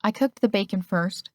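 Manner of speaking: ordinary delivery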